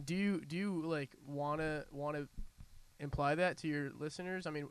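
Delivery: ordinary talk, nothing marked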